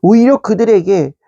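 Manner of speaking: normal talking voice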